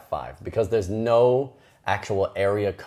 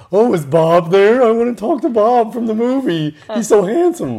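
swooning fan voice; 13 semitones higher, 9 dB louder than usual. Retold or imitated. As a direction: gush over Bob.

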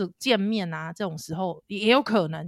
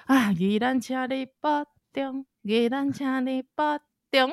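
solemn; 5 semitones higher, slower than usual. Acted. Slow formal sign-off echo.

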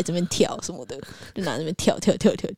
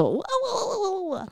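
excited; 7 dB quieter, faster than usual. Retold or imitated. Spotlight on the last three.

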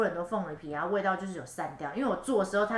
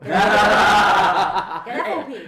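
laughing; 11 dB louder, much slower than usual. Real, not acted. Laughing comment.

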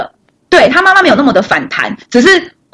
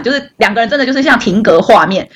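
ordinary talk

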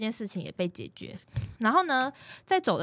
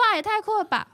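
amazed; 13 semitones higher, faster than usual. Retold or imitated. Retells her reaction to grandpa.

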